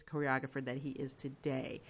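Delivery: usual speaking voice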